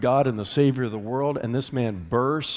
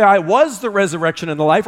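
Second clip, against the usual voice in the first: "was" pushed up and stressed as the wrong tense; 7 semitones higher, 8 dB louder, faster than usual. Shown as a demonstration. Pastor shows the church the wrong tense, stressing "was". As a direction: hit "was" to show what he didn't say. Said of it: "Jesus didn't say".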